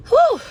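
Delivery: high, loud "whew"; big high-pitched sigh of relief; exhaled whew. Real, not acted. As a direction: let out a sudden high whew. Pauses for breath mid-story.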